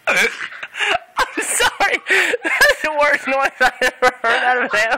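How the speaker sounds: words broken by laughter, long high laugh; can't get through it without laughing. Real, not acted. Owner laughs at the cat's growl, laughing through the words.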